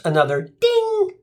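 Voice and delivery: ordinary talk, nothing marked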